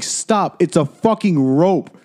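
exasperated scolding. Retold or imitated. Retold scolding of the drunk girl.

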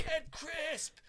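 high, drawn-out heckling shout; loud, high-pitched crowd shout. Retold or imitated. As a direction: yell the name like a chanting superfan. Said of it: "I'm just shouting".

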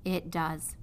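"It does." dropped low and choked; sniffling. A narrator's reading. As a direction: say "It does." softly after a sniff. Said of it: "He sniffed".

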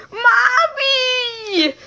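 very high, mock yell for mom; imitating a yell. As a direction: tease a scared kid calling mommy. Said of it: "[name]' impressions of James yelling".

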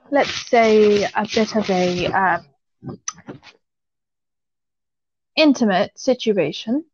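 halting, with long pauses between phrases; careful, guarded telling. Real, not acted. Telling a friend's intimate story out of courtesy, guarded and halting. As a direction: pick each word delicately, stretching and stalling. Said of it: "I'm gonna say this as ambiguous as I possibly can".

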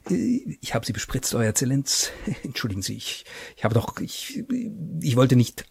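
soft, nervous murmured apology; murmuring. Acted. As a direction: mumble it timidly. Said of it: "murmelte er".

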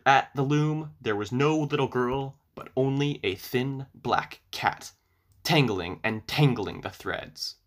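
ordinary delivery, nothing marked

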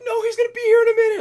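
put-on panicked girlfriend. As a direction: mimic a panicked girl begging the staff. Girl pleading at the gate.

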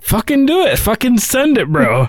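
frat bro. Retold or imitated. Quoting own hype advice.

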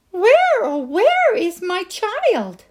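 high, loud worried cry, drawn out slowly; alarmed mother. Acted. Sal's mother sees a bear cub instead of Sal, high alarmed cry. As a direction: call out for her lost child.

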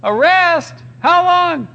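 high-pitched comic disbelief; hammed-up incredulous squeal; incredulous stomach. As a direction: question it back as an alarmed belly. Stomach questions the owner.

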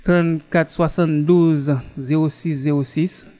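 ordinary talk, nothing marked

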